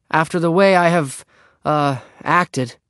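halting apology, dropping from a high call; reluctant. A narrator's reading. Henry struggles to apologise to Beezus, halting and reluctant. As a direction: hesitate before the hard word. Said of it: "Henry found difficult to speak".

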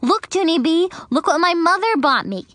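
put-on bright high eager girl's voice; excited. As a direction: show off new shoes excitedly. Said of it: "She ran to me very excited".

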